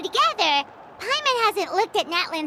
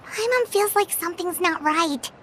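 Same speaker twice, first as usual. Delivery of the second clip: usual speaking voice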